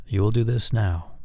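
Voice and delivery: "now" drops in pitch as a command; falling command inflection. Shown as a demonstration. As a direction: give an order, letting "now" go down at the end. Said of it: "My voice inflection went down".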